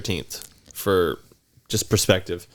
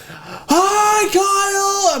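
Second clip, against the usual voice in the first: put-on very high, drawn-out eerie kid call; creepy kid greeting; 3 dB louder, much slower than usual. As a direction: sing the name out like a horror-film child. Imitating creepy neighbor kid.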